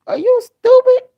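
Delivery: high shrill mock yell of the daughter; daughter's yell. Daughter yelling at her boyfriend, very high and loud. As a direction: squeal it high and loud, indignant. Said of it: "That's what she said".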